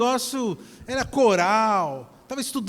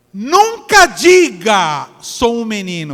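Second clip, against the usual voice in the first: booming prophecy; 11 dB louder, much slower than usual. Retold or imitated. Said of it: "ele falou assim".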